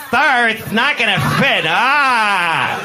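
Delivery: high, lisped, hammed-up camp voice; exaggerated camp steward. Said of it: "He wasn't like".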